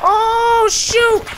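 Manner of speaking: a high, loud sudden cry; startled yelp. Real, not acted. Gamer yelping at a sudden threat. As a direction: yelp as danger hits.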